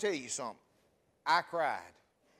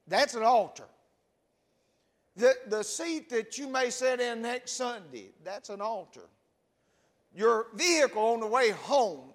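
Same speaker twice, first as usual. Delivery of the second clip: loud altar-call preaching, driving each line; preaching at full force; 5 semitones higher, 7 dB louder than usual. Acted. Pastor urging sinners to the altar, loud preaching. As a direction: preach it out, every seat an altar.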